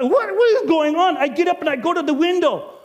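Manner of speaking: high, loud and jolted awake; an innkeeper woken at night by shouting, high and loud; startled alarm. Acted. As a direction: wake up alarmed at the noise.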